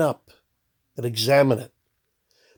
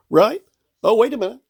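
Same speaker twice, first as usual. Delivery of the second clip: ordinary talk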